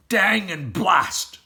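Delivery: slow, loud curse; tired farmer swearing; grumbling oath; cross farmer. Acted. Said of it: "said Bogus".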